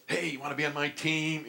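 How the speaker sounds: high-pitched imitation of a recruiting coach; mock a coach calling a player over; imagined eager coach. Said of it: "coaches that pursued me".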